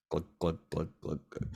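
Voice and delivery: low glugging noises; rhythmic mouthed glug-glug; imitated gulping. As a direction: mimic a drink being swallowed down. Imitates the drink going down.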